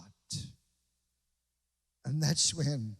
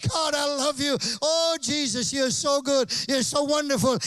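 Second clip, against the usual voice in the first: loud praise; 8 semitones higher, 7 dB louder than usual. Pretend. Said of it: "speak out and say".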